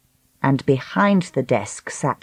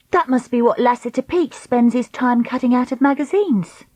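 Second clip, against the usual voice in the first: put-on high-pitched girl character voice; Dakota, young heroine; 7 semitones higher than usual. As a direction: pitch it up, brisk storybook heroine.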